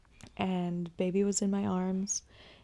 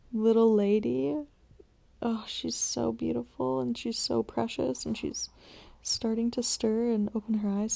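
tender whisper; 4 semitones higher than usual. Real, not acted. Mother gazing at her stirring newborn, hushed and doting. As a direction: whisper lovingly so she won't wake. Admiring the baby in her arms.

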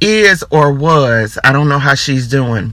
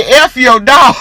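laughing shout; 7 semitones higher, 4 dB louder, slower than usual. Real, not acted. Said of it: "I should not be laughing this hard".

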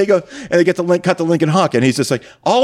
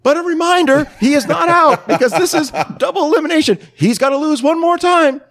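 mock announcer. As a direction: ham up the MC stressing double elimination. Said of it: "the announcer goes".

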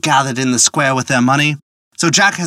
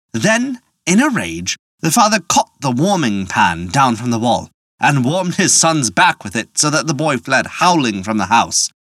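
plain reading voice

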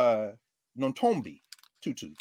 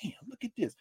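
hushed aside; 4 semitones higher, 9 dB quieter than usual. Retold or imitated. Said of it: "I'm like".

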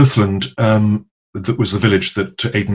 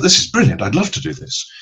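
retold enthusiasm; 7 semitones higher than usual. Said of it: "maybe my enthusiasm".

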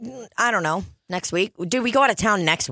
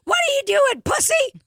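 very high squeaky taunt; Mister Hanky squeak. Retold or imitated. Mister Hanky teasing sleepy Tony, very high squeak. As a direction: needle him in a tiny cartoon squeak. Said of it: "Mister Hanky's like".

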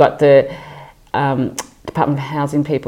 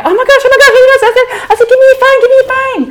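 much higher, louder giddy gasping; fiancée squealing, demanding his phone to call mum; excited squeal; 9 dB louder, faster than usual. Retold or imitated. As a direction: burst with newly engaged joy. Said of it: "And I go".

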